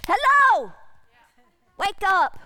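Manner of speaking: loud, high call; God calling out. Acted. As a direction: wake them with a loud high hello. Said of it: "he's saying".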